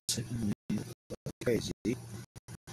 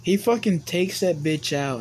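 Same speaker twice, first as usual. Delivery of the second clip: ordinary talk, nothing marked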